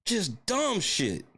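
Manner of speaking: high, slow, drawn-out complaint; exasperated groan. Real, not acted. Fan groaning over a dumb loss, high and slow. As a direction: drag it out in disgust.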